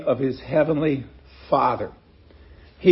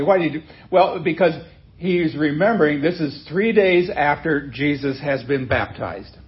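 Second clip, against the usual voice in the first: usual speaking voice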